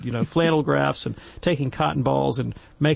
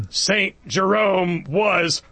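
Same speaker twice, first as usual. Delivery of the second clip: slow, louder mock stern verdict; imperious Missus Champion; 2 semitones higher, 3 dB louder, much slower than usual. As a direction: hand down the ruling like a strict teacher. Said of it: "invoke the spirit of Missus Champion".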